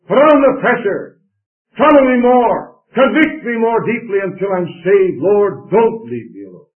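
desperate pleading cry. Acted. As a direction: cry out to God for help. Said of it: "you'll say".

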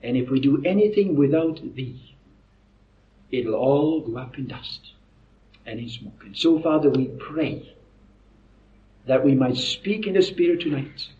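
low, soft, reverent prayer with pauses. Real, not acted. Preacher asking God to guide them tonight, hushed prayer. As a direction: pray softly, pausing between phrases.

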